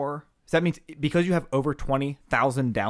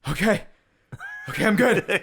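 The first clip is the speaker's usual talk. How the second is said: put-on high, gasping kid psyching himself up; imitated shaky child after throwing up; recovering sick kid; 6 semitones higher than usual. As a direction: pant it out like a kid pulling himself together. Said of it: "he throws up and he goes".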